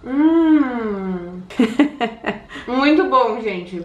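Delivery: talking behind hand; muffled through covering hand; chewing with mouth full. Real, not acted.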